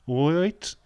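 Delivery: loud high Brummie "All right"; mock Birmingham greeting; hammed-up Brummie hello; Brummie accent. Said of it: "Uh, Birmingham".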